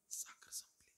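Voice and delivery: barely audible, secretive whisper; whisper in the ear. Retold or imitated. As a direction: whisper it close to her ear. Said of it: "सासूच्या कानाजवळ तोंड नेलं". (कानात हळूच कुजबुज)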